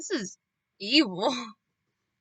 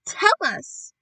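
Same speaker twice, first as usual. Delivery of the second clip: a higher, louder eager plea; eager cry; 6 semitones higher, 6 dB louder than usual. Acted. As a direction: call out hungry for the plan. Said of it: "they cried".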